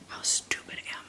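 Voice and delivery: soft unvoiced whisper; hushed muttered asides. Real, not acted. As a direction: whisper as if not to wake anyone. Whispering to camera.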